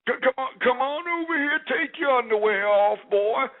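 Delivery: high-pitched put-on grandpa voice; Grandpa Paul. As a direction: play a creepy old man accosting a caller. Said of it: "Oh, you want Grandpa Paul?".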